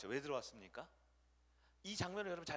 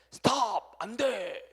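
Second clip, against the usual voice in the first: loud, sharp halt; stopping shout; 5 semitones higher, 15 dB louder, much slower than usual. Pretend. God halting Saul's sacrifice with a loud cry. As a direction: slam on the brakes.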